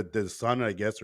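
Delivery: ordinary talk, nothing marked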